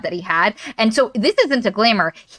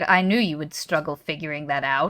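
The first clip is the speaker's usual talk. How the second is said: low, soft deadpan retort; dry teasing; 6 semitones lower, 7 dB quieter than usual. Retold or imitated. He teases her for not understanding, drily. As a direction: drop low and quiet for a dry jab. His dry reply, retold.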